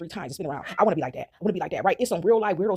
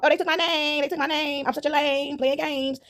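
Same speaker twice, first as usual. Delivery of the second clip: whiny higher mocking voice; mock whining; 8 semitones higher, slower than usual. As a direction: whine like a sore copycat.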